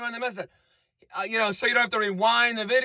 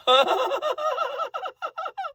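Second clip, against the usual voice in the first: high mock laugh, much higher; imitating a ridiculous high laugh; imitated ridiculous laugh. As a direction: cackle like the singer. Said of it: "he does his thing".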